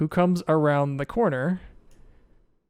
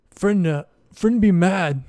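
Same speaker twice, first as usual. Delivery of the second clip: higher, louder simple-minded Verbeeg; worried simple monster; 4 semitones higher, 6 dB louder, slower than usual. Acted. Verbeeg warns Rogar about his friend, simple worried voice. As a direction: warn them in loud, broken speech. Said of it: "He says".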